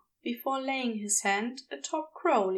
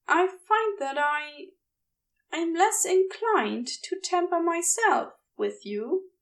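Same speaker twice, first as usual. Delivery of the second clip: higher, halting gentleman; hesitant Ezra voice; 6 semitones higher than usual. A narrator's reading. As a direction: pause and reach for the words.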